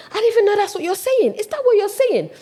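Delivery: high-pitched, dramatic disbelief; incredulous. Retold or imitated. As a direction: question it in astonished disbelief. Acts out disbelief at the name.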